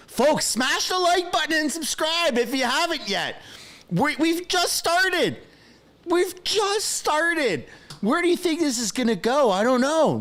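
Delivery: high, excited call to viewers; hyped-up. Real, not acted. Streamer urging viewers to subscribe, high hyped-up voice. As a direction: pump up the chat.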